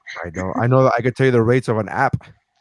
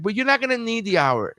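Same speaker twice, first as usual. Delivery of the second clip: cocky; 11 semitones higher than usual. Retold or imitated. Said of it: "But, like".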